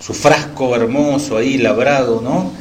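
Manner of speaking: normal talking voice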